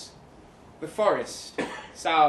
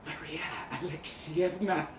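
softer, hushed name; soft whisper; 6 dB quieter than usual. Acted. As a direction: breathe her name close to her ear. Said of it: "I whispered".

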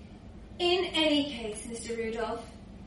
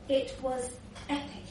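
softer, hushed EPIC; awed reminiscence; 3 semitones lower, 7 dB quieter than usual. Acted. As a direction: drop the volume and savour it privately. Same memory, softer second take.

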